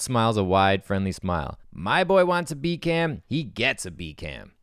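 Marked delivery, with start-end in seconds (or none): 1.7-4.5 slow, proud producer voice; a hint of a smug producer. Harold voiced in script.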